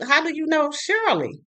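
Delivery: high, sharp disbelief; stunned confrontation; incredulous demand. Retold or imitated. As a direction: demand an answer, shocked. Confronting boyfriend on phone.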